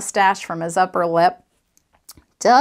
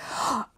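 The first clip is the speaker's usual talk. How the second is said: sharp intake of breath; quick acted huff inward; angry gasp; 3 semitones higher, 6 dB quieter than usual. A narrator's reading. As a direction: gasp before the outburst. Angry intake before outburst.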